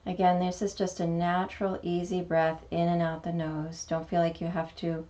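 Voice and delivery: calm meditation guide, long pauses between phrases; guided meditation. Acted. Teacher guiding beginners through a mini meditation, calm meditation voice. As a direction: lead gently into stillness, unhurried, letting each phrase settle.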